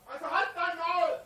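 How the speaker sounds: loud angry command; berserk rage. Real, not acted. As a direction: bark it at them. Shouting down hecklers.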